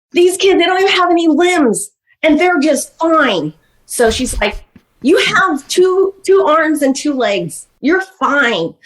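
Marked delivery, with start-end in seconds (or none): 0.1-3.5 scolding mother retold. Retells mother's lecture to child. 5.0-8.7 high, slow, emphatic lecture; acted-out brisk dismissal, each point stretched; insistent mother retold. Retells mother's lecture to child.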